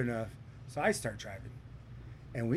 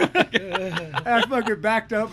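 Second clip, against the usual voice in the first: laughing; 8 semitones higher, 8 dB louder, much slower than usual. Real, not acted. Laughing retelling escape.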